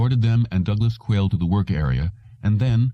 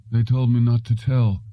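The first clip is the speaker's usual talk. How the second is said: dull drugged mumble; 5 semitones higher than usual. A narrator's reading. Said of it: "mumbled in a dull, drug-saturated voice".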